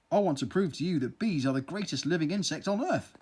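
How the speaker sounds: plain reading voice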